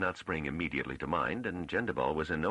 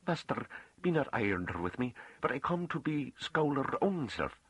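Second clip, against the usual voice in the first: pleading farm woman; 11 semitones higher than usual. A narrator's reading. Farm woman asks the master to take her seriously, pleading. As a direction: plead earnestly, pitched high in dialect.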